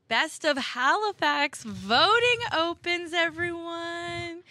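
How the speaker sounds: high, drawn-out announcer call; grand announcement. Acted. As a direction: stretch it out like a big reveal. Announcing award voting to listeners.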